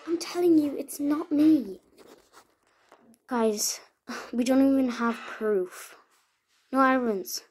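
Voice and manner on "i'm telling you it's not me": protesting. Acted. Accused friend protests innocence.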